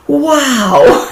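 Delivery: high, delighted wow with a laugh; thirsty traveller spotting an oasis, thrilled; excited wow. Pretend. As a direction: gasp it out like you just saw water. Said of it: "that sort of excited feeling".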